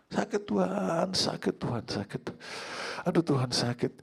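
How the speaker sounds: high-pitched mock moaning to God; acted pained plea. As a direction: whimper in pretend pain, calling on God. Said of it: "nah, anak bilang sakit". (rintihan kesakitan yang ditirukan)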